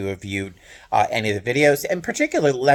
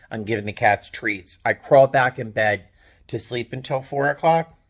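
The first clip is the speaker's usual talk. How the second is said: ordinary talk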